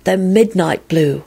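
slow, entranced sigh; dreamy, drawn-out wonder; lovestruck sigh. A narrator's reading. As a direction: breathe it out in a trance of love. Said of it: "he sighed".

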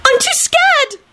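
very high panicked whine; high-pitched helpless panic; frightened wail. A narrator's reading. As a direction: wail it like a scared girl. Frightened Esther pleads to Dragomir.

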